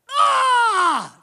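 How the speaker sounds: very high, loud sudden yelp; imitated cry out. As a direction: let out a sharp startled cry as he falls. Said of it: "The guy goes".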